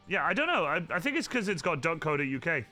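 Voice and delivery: normal talking voice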